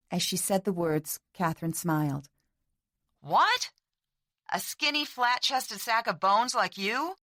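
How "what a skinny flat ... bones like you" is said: teasing disbelief. A narrator's reading.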